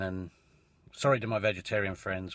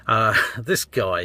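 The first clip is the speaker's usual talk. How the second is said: slow, giggling through the words; can't hold back a laugh, drag it out; laughing slowly at your own joke; 5 dB louder, much slower than usual. Real, not acted.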